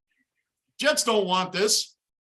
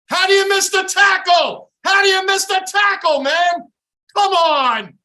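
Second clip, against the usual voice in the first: higher, much louder enraged yell; furious shout; 7 semitones higher, 8 dB louder than usual. Real, not acted. Fan raging at a defender's missed tackle, furious.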